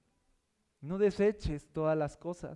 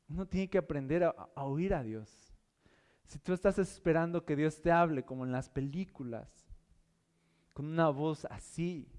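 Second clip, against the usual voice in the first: normal talking voice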